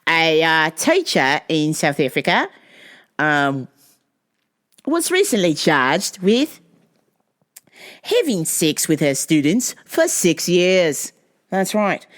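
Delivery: badly faked South African accent sliding into Australian; amateur South African newsreader accent; attempted South African accent. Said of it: "do a South African accent".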